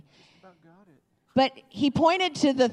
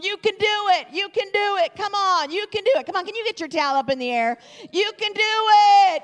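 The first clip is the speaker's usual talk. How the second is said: upbeat imitated stadium cheer, pitched up; mock crowd chant, bouncy and high; 7 semitones higher, faster than usual. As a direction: chant it like fans egging on a player. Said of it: "They were going".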